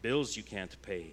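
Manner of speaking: ordinary delivery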